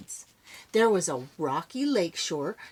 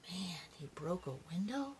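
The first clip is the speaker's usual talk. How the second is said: whisper; 14 dB quieter than usual. Real, not acted. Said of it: "reader whispers aside".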